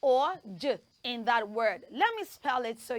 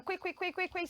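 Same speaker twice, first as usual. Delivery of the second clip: quick soft repeat; 4 semitones higher, 8 dB quieter, faster than usual. Shown as a demonstration. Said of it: "Let's do a quick one".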